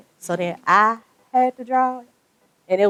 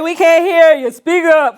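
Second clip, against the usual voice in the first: loud, high heckle from the crowd; calling out; 8 semitones higher, 10 dB louder than usual. Retold or imitated. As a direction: imitate an audience yelling at the speaker. Audience calling out to a speaker.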